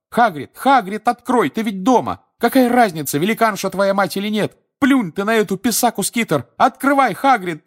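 high, loud pounding-on-door shout. A narrator's reading. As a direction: yell through the door. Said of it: "Гермиона закричала". (кричит за дверью)

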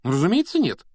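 high, loud surprised retort. A narrator's reading. Hermione answering Harry's doubt, surprised. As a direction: answer as if it were obvious, pitch jumping up. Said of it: "удивилась Гермиона".

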